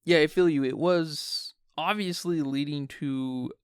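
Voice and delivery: usual speaking voice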